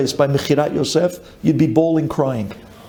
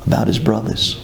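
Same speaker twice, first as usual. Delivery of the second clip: ordinary talk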